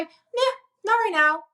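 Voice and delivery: put-on high, slow, sulky kid voice; child's refusal. Mom imitating her child turning down an apology, high and slow. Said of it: "sometimes they'll say".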